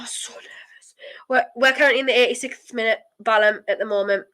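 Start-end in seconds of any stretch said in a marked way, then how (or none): 0.0-0.9 soft, breathless whisper; nervous whisper. Real, not acted. Fan in the eighty-sixth minute whispers, nervous. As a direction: hush it out in tension.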